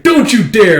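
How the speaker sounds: high, loud acted threat; threatening warning. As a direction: warn him off forcefully. Threat during acted fight.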